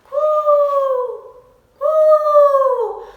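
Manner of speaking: high, loud, drawn-out cooing call; owl call. Acted. Mother owl calling across the trees, loud high coo. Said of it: "Little Owl heard a familiar sound".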